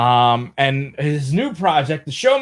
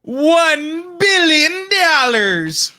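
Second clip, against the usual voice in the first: very high, long drawn-out exclamation; hammed-up jackpot cry; 12 semitones higher, 3 dB louder, much slower than usual. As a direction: stretch it out high like a game-show jackpot. Announcing the huge jackpot.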